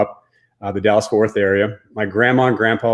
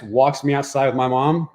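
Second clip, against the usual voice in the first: normal talking voice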